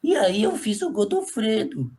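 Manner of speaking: voz de boneco, arrastada, levemente caricata; Godofredo. Shown as a demonstration. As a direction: falar devagar, com um toque de personagem. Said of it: "vou tirar esse afetado dele". (voz lenta de boneco, sem afetação)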